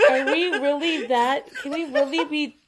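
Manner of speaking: high, loud, laughing question; real incredulous laughter; laughing disbelief. Real, not acted. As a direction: ask it while cracking up. Cracking up at being recognised.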